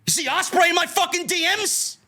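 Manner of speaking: rising fed-up yell; angry shout. Real, not acted. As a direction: yell it out in real exasperation. Angry rant about wrestlers.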